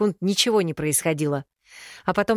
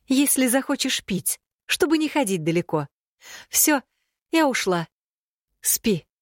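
higher, bustling motherly voice; brisk cheerful mother; 8 semitones higher than usual. A narrator's reading. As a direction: chirp it out and leave.

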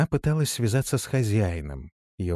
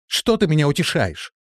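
high, loud, distressed protest; anguished exclamation; 8 semitones higher, 6 dB louder than usual. A narrator's reading. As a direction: cry out against being comforted. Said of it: "воскликнула Тора". (отчаянный возглас)

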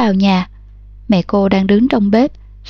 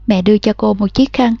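usual speaking voice

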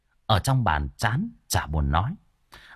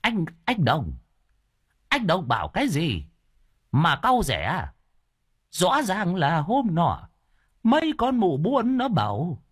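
put-on deaf old man; 7 semitones higher than usual. A narrator's reading. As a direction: protest as a hard-of-hearing elder. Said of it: "ông cụ Luân điếc nghe câu được câu trăng".

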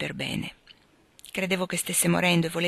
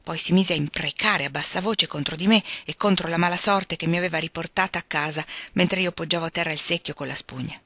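ordinary delivery, nothing marked

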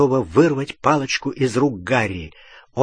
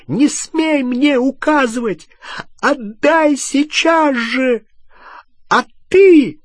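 high, loud, drawn-out commands; furious shrill demand; enraged screaming; 13 semitones higher, 6 dB louder, slower than usual. A narrator's reading. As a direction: scream the orders, climbing to a loud accusation. Enraged Hermione screams orders.

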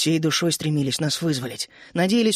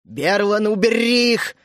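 slightly raised, tossed-off command; brusque order; 3 semitones higher, 4 dB louder, slower than usual. A narrator's reading. Тереза ordering Берлан to remove the two, brusque order. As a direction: throw the order over your shoulder. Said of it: "кинула через плечо Тереза".

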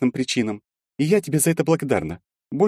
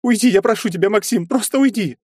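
high, louder, weeping plea; tearful pleading; 4 dB louder than usual. A narrator's reading. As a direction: beg him to leave through tears. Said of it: "и расплакалась".

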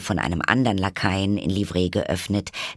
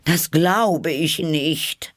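high, slow dismissive bird voice; high pigeon voice, unconvinced; doubting pigeon; 9 semitones higher, 4 dB louder, much slower than usual. A narrator's reading. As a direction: brush it off slowly. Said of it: "sagte die Taube".

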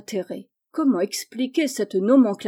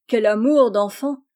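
fond exclamation; 7 dB louder than usual. A narrator's reading.

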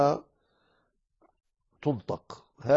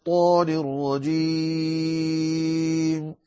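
slow, melodic tajweed chant; Quran recitation; 4 semitones higher, much slower than usual. Acted. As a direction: recite the verses with long held vowels.

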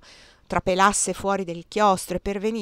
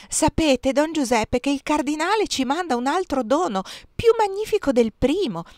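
excited announcement; 7 semitones higher than usual. Acted. Excited news of a second gift.

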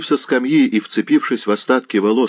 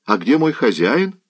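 put-on higher-pitched servant boy; young servant lad; 8 semitones higher than usual. A narrator's reading. Servant lad greeting the knights, higher and deferential.

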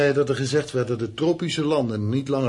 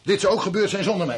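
higher, louder and sharp; angry outburst; 10 semitones higher, 6 dB louder than usual. Acted. Husband shouting down his wife's accusation, angry. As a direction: cut her off in anger. Heated quarrel between the couple.

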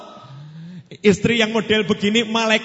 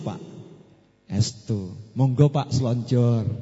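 gentle polite wife; 8 semitones lower, slower than usual. Acted. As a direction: coax him kindly to a massage. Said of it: "Coba kalau istri ngomongnya begitu".

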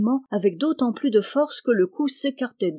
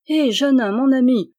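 louder, higher, a sharp impatient call; 5 semitones higher, 7 dB louder than usual. A narrator's reading. Lady of the castle impatiently calls to the man-at-arms, louder. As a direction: cut him off, losing patience. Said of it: "avec un mouvement d'impatience".

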